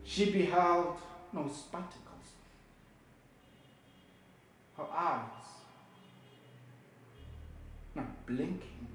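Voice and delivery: low, very soft, halting narration; subdued, haunted telling; hushed grief. Acted. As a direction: let it sink almost to nothing, with long pauses. Child watching her mother die.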